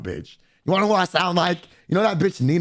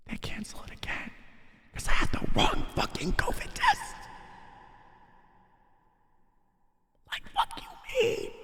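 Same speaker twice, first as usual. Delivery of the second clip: hushed, breathless disbelief; stunned whisper; 8 dB quieter than usual. Retold or imitated. Telling friends the test was wrong, shocked whisper. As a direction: whisper it like you can't believe it. Dramatic aside about the cancellation.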